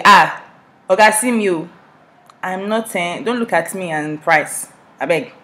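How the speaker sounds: loud Nigerian haggling protest; broken-down English. Acted. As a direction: bargain with a driver in Nigerian vernacular. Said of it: "breaking down the English".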